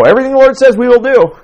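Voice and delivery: high, loud, fast mocking of the people; mocking Israel's overconfident promise, high and loud; mock eager promise.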